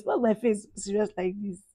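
usual speaking voice

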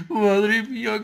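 high, elated laugh; excited laughing. Real, not acted. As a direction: laugh with disbelieving joy. Just won first match.

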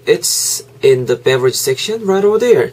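slow, careful it's in the beverage section right over there; slow model answer. Shown as a demonstration. A teacher modelling the store clerk's answer, slowly. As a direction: spell out the clerk's reply word by word.

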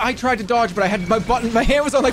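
high, loud panicked yelling; frantic gaming outburst. Real, not acted. Streamer yelling at his game over a missed dodge, frantic and loud. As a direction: yelp in real panic as the dodge fails.

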